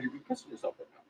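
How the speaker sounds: normal talking voice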